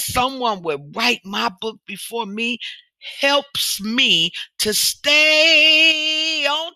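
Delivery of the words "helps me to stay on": very slow, high, loud stay on; drawn-out stress. Acted. Speaker stressing her point to listeners, slow and loud. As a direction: stretch stay on out for emphasis.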